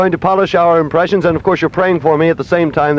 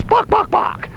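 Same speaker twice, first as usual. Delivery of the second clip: high-pitched mock chicken; chicken clucking; 4 dB quieter, slower than usual. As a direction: cluck it out like a hen. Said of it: "dressed as a chicken and who goes".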